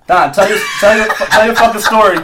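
impatient demand. Real, not acted. Host pushing co-host to take over, exasperated shout.

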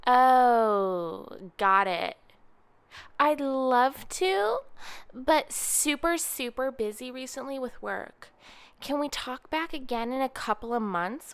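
mocking her brush-off. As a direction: ham up her sweet-sounding no. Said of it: "And she goes".

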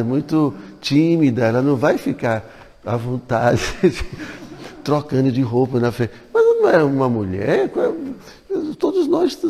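ordinary talk, nothing marked

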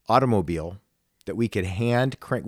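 ordinary delivery

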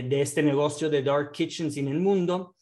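ordinary delivery

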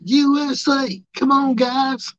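high, loud chanting fan voice; high loud imitation of a chanting wrestling fan; nerdy wrestling fan. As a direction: whip up the crowd like a geeky fan. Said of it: "Be like".

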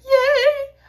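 put-on very high squealed yay like a little kid; high delighted child's cheer; kid at Christmas. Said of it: "'cause I was like".

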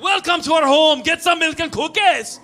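loud, high, hammed-up Scottish accent. Mom imagined welcoming guests, loud mock Scottish accent. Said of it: "By the way, Scottish voice".

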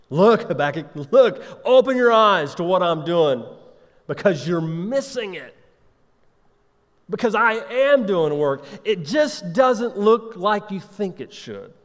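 loud, high-pitched appeal with emphatic key words; urgent exhortation. Acted. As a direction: press the listener to wake up and look. Acts God urging Habakkuk to look.

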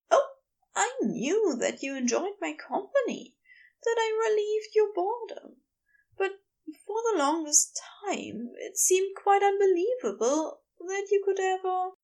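high character voice, full of feeling; high, earnest explanation to a beloved; earnest, confessing gentleman. A narrator's reading. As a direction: confess it pitched well up.

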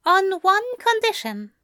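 high, measured angel voice; conceding in a high character voice; angel agreeing conditionally. A narrator's reading. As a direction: pitch it well up, setting a term. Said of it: "Aziraphale finally agreed".

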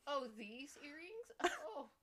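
high, slow, faux-casual show-off; mock flexing. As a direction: act coyly surprised to be noticed.

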